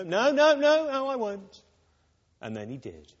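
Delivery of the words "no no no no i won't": high, loud insistent protest; no no no, much higher and louder; Peter's loud denial. Retold or imitated. Peter insisting to Jesus he will never deny him, high loud protest.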